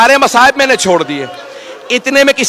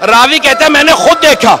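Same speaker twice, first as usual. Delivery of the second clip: impassioned sermon recitation; 4 semitones higher than usual. Acted. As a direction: build the mourners toward tears. Majlis recitation rising.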